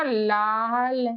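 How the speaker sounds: लाल stretched very slowly; drawn-out word. Shown as a demonstration. Teacher models लाल for a student, very slow. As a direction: stretch लाल out long for the class. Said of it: "कैसे हो जाएगा?".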